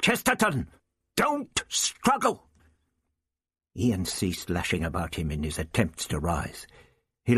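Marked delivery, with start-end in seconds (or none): 0.0-2.5 high urgent yell over the din. A narrator's reading. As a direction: shout a desperate warning. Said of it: "abruptly yelled above the din".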